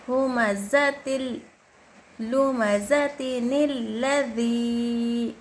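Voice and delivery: slow, measured chant; elongated Quran recitation. Shown as a demonstration. Teacher showing students the one-alif stretch, slow recitation. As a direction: draw out the long vowel on the final ज़ी. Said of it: "एक अलिफ की मकदार खींचना चाहिए".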